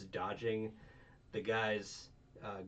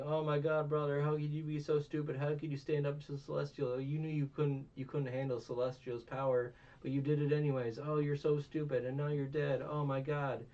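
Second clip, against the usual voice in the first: raised-pitch pretend wailing; imitated distraught scolding, higher voice; mock crying; 5 semitones higher, 4 dB louder than usual. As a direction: lament loudly over a fallen brother, hammed-up. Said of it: "starts crying over him".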